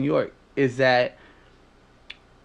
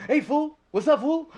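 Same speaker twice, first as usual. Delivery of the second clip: high-pitched mock homeboy greeting; Mondo; 11 semitones higher than usual. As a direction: imitate a friend's chesty "fool" greeting, much higher. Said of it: "He was doing the whole chest thing".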